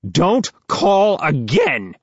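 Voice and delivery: high, slow, furious warning; angry. A narrator's reading. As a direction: snap it out, fed up. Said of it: "This time the man sounds pissed".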